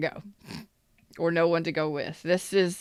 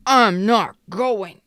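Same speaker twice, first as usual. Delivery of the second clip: slow, louder, drawn-out pouty refusal; stubborn refusal; 2 semitones higher, 7 dB louder, much slower than usual. Retold or imitated. Reader mimics a stubborn Cancer refusing, slow pouty. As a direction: dig in your heels and refuse. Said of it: "So you're being stubborn".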